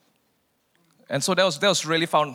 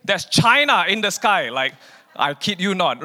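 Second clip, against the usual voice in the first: ordinary talk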